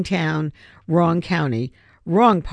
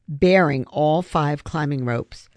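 ordinary delivery, nothing marked

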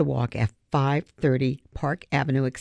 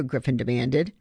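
plain reading voice

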